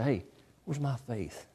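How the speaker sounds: low, very soft private question; quietly searching your own heart; hushed self-questioning. Retold or imitated. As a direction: ask it inwardly, almost to nobody. Imagined self-questioning in mirror.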